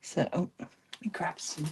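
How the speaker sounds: soft whisper while busy. Real, not acted. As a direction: murmur it to yourself in a whisper.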